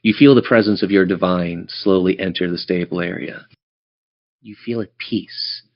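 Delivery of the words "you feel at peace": usual speaking voice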